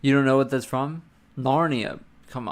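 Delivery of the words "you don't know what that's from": ordinary delivery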